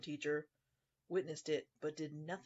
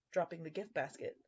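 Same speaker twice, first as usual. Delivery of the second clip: usual speaking voice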